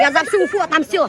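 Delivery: yelling across the room. Real, not acted. Triumphant shout after winning.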